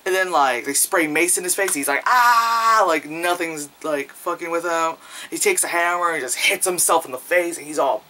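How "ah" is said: mock yell. Mace sprayed in his face.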